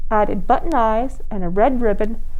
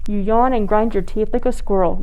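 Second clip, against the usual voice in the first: plain reading voice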